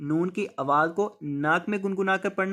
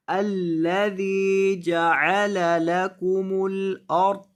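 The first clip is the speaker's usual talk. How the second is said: slow, drawn-out tajweed chanting; Quran recitation; 2 semitones higher, 4 dB louder, much slower than usual. Shown as a demonstration. Teacher reciting the verse for students, slow chant. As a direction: recite the verse slowly with long held vowels.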